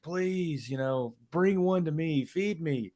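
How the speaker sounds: high, soft imitation of begging; mock screamed plea. Retelling a man calling up to giants, mock pleading. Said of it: "down there screaming at him".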